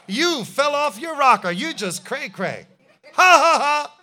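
loud mocking laughter breaking through the words; hammed-up Sarah laughing at the angels; Sarah laughing in disbelief. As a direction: cackle like the idea is ridiculous. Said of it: "What did she do?".

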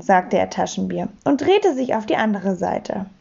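plain reading voice

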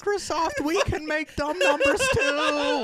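high, slow, laughing taunt; mock Apple exec. Mock Apple boss taunting Microsoft, high and laughing.

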